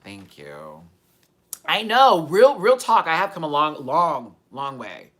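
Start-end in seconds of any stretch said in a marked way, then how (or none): 1.7-5.1 loud, high and fired up; bursting with energy; excited. Real, not acted. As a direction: push it out with real excitement. Fired up after the squeal.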